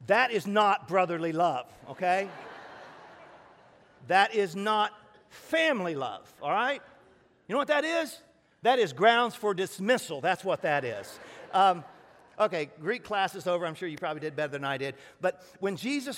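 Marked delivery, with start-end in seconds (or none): 0.1-11.2 loud, high mock indignation; mock outrage. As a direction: play up the fake offense to the crowd. Mock outrage at colleague's text.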